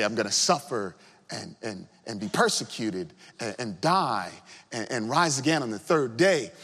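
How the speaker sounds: quiet, low, downcast announcement; somber. Retold or imitated. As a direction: let it sink like grim news. King's unattractive speech to followers.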